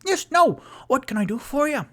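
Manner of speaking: very high, loud delighted halfling host; cheery halfling. Acted. Mister Peabody greeting returning guests at his door, very high and delighted. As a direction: squeal a bright welcome up high. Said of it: "and goes".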